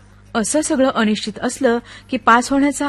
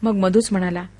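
ordinary delivery

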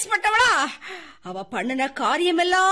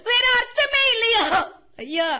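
higher, louder sobbing question; crying outburst; 7 semitones higher, 4 dB louder than usual. Acted. Widow wailing to her family over their greed. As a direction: break into a crying wail.

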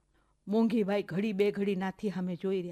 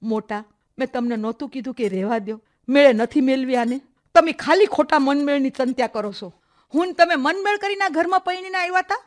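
loud rebuke, climbing higher; angry reproach; 3 semitones higher, 9 dB louder than usual. Acted. Aunt confronting her sister-in-law over the match, reproachful. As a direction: challenge forcefully, rising to a high final question.

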